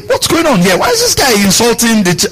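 high-pitched, indignant imitation of a challenger; indignant young David. Preacher acting David questioning the brothers, indignant. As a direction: ask it high, in mock outrage. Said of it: "when David came and asked the brothers".

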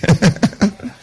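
light chuckle in quick bursts; real chuckle. Real, not acted. Preacher amused after urging the church, chuckling. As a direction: laugh softly to yourself.

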